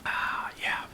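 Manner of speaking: soft unvoiced whisper for a quiet cheers; whispered toast. Real, not acted.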